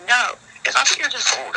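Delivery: fed-up, insistent quote; retold exasperated objection. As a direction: push back on a parent's excuse. Retells arguing with parent.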